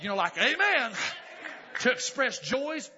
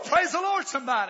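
normal talking voice

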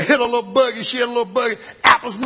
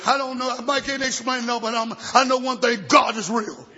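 full-force preaching; 6 semitones higher than usual. Real, not acted. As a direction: preach it hard to the congregation.

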